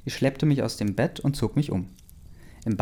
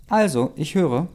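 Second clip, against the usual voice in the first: higher, louder, demanding; impatient; 6 semitones higher, 4 dB louder than usual. Acted. Bella impatiently demands Jack explain, higher and louder. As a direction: push him to finally speak. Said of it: "sagte ich nun ungeduldiger".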